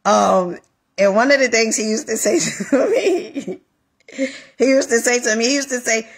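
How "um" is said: normal talking voice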